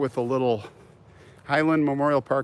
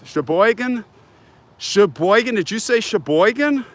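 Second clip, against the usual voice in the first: surprised; 7 semitones higher, 3 dB louder than usual. Real, not acted. Excited at a near-right guess.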